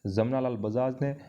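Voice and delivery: ordinary talk, nothing marked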